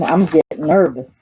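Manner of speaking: ordinary delivery, nothing marked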